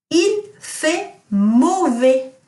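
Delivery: teacher quizzing class with Il fait mauvais; slow model sentence. Shown as a demonstration. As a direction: stretch Il fait mauvais out word by word. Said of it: "what does it mean if I say".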